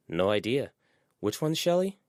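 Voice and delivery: lightly voiced girl asking, pitch up; a hint of a confused teen asking; Yadira, puzzled. A narrator's reading. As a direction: ask it with a rising, puzzled lift.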